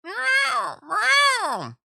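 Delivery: very high, loud meow; cat meowing. A narrator's reading. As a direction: yowl like an impatient cat at the door. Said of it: "interrupted Simpkin".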